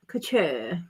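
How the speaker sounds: "Couture" as a playful "kuh-tuh"; mock-fancy "Couture", ending in "tuh"; affected couture. Shown as a demonstration. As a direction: say "Couture" with the final r dropped. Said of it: "I'm gonna say it like that".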